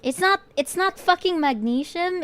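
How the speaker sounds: loud, high disbelief; incredulous outburst. Real, not acted. Streamer stunned she said it wrong, loud and high. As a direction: protest it like you can't believe it. Outraged at her mispronunciation.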